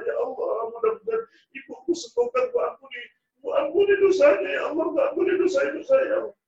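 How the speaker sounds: high, breaking cry of prayer rising to a shout; tearful pleading prayer. Acted. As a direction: beg God through tears for his mother. Son's pleading prayer for mother. (doa memohon sambil menangis dengan suara tinggi)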